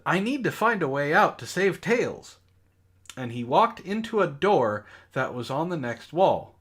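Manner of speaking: ordinary delivery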